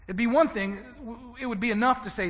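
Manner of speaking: ordinary delivery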